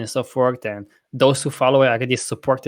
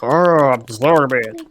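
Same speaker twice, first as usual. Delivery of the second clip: slow, louder, dreamy mock voice of a friend on mushrooms; hammed-up spaced-out friend; tripping stoner; 5 dB louder, much slower than usual. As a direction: stretch it out like you're blissed out. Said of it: "Cody will put mushrooms in his system".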